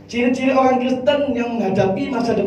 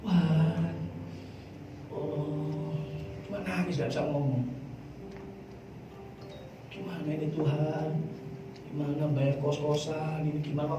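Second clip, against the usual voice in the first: soft, low plea to God; hushed mock-weeping prayer; retold tearful prayer; 6 semitones lower, 11 dB quieter than usual. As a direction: pray helplessly, very softly, as if retelling tears. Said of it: "saya cuma nangis sama Tuhan".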